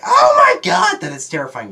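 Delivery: high shrieking "oh my god"; a shriek of mock terror; terrified shriek. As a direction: shriek it in shock, then drop back. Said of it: "I was like".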